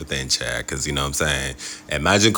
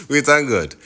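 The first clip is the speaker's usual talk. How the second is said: laughing; 9 semitones higher, 4 dB louder than usual. Real, not acted. Laughing after the outage.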